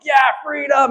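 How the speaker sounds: high shouted battle cheer. Retold or imitated. Mock Scots cheering for freedom, loud shout. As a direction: cheer like a rebel army.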